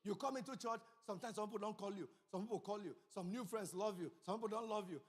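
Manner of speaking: ordinary talk, nothing marked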